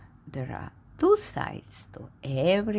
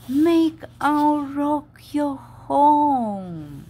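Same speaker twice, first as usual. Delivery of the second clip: higher, louder and very slow; grand slow reading; slow emphatic reading; 7 semitones higher, 8 dB louder, much slower than usual. Acted. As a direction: proclaim it slowly. Reading the reversed book, emphatic.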